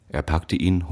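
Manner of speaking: ordinary delivery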